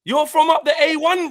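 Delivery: loud, high, indignant insistence; outraged retort. Retold or imitated. Retelling his outraged retort.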